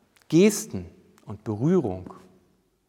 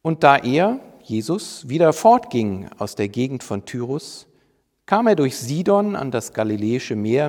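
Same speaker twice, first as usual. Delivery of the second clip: louder, projected Bible reading; scripture reading; 7 dB louder than usual. Acted. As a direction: proclaim the Gospel passage to the congregation. Reads the Bible passage aloud.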